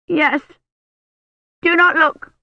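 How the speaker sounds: put-on high, loud child's protest; a small girl guarding her hurt knee; upset little girl. Agnes refuses to show knee.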